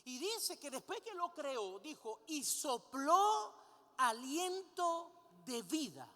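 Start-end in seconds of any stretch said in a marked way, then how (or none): 2.3-6.1 loud, drawn-out "sopló", then slow "aliento de vida"; emphatic scripture quote. Shown as a demonstration. Preacher quoting how God gave life, slow and emphatic. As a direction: stretch "sopló" long and loud, spacing out what follows.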